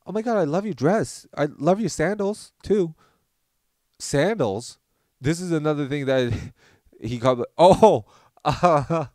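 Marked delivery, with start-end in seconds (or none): 0.1-2.9 higher, lilting gushy praise; gushing compliment. Retold or imitated. Roommate compliments women on dress and sandals, gushing. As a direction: imitate a friend gushing over an outfit.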